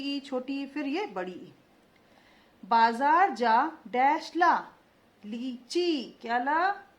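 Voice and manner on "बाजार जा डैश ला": slow, louder, every syllable clear; slow dictation. Shown as a demonstration. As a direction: dictate it slowly to the class. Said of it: "देखते जाइए मैं कैसे उच्चारण कर रही हूँ".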